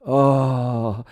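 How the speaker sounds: loud, drawn-out dismayed "oh"; imitated group groan. Everyone on set sees beads fly, imitated groan. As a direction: groan like a whole crew let down. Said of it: "you hear everyone on the set".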